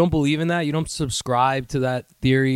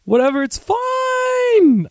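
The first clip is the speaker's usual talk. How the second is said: squeaky, very high fake nonchalance; little high voice; 11 semitones higher, 3 dB louder, slower than usual. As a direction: pitch it absurdly high and stretch it out. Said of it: "I do that little voice thing".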